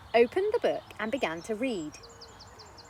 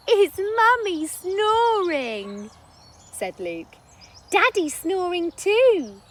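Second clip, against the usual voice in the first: put-on high, slow little boy voice; boy noticing parents snoring; amused child; 9 semitones higher, 8 dB louder, slower than usual. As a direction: point it out like a kid.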